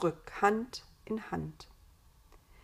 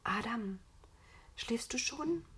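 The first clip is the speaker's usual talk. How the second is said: soft bedtime whisper, question lifting high at the end; 5 semitones higher, 7 dB quieter than usual. A narrator's reading. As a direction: whisper to someone who may be asleep. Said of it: "flüsterte Eva". (flüsternd)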